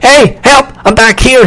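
high, loud, panicky cry; screamed plea for help. A narrator's reading. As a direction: call out in alarm as if trapped. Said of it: "he screamed".

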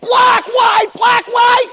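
high, loud competing shouts; frantic high-pitched chant of rival cries; uproar of shouting. Retold or imitated. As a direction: yell like a crowd arguing back and forth. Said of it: "the whole place is in an uproar".

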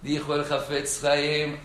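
ordinary delivery

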